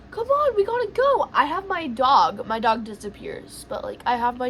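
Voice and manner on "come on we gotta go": high, loud retold urging; urgent plea. Hurrying everyone into the shelter, urgent retold plea.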